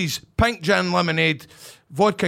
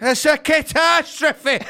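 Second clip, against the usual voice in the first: high, slow, drawn-out comic lament; mock outrage; 5 semitones higher, slower than usual. Comic pundit giving thoughts on Ross County, mock outrage. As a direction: overdramatise the disaster.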